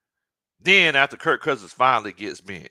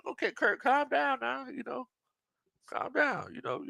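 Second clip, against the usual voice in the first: mock calming; 13 semitones higher, 6 dB quieter than usual. Telling Kirk to calm down.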